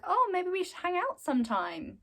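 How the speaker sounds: high-pitched imitation of a girl; light, high mock-girl voice; friendly schoolgirl. Retold friendly invitation from a girl.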